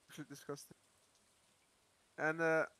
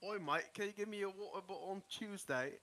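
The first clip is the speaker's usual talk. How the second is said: showy, higher English accent; 4 semitones higher than usual. Shown as a demonstration. Showing friends a better fake English accent. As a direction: put on a stock British "oi, mate" accent. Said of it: "I can do a way better English accent".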